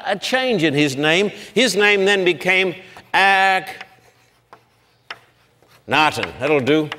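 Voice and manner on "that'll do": usual speaking voice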